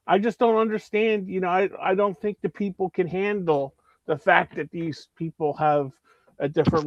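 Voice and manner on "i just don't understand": usual speaking voice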